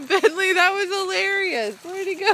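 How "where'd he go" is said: ordinary talk, nothing marked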